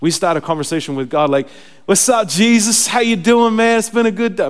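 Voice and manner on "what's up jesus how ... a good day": loud, higher, exuberant hail to a buddy; chummy morning greeting. Retold or imitated. Believer greets Jesus in the morning, exuberantly chummy. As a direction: greet Jesus like an old pal, hammed-up. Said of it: "Maybe you don't talk like that, but I talk like that in the morning".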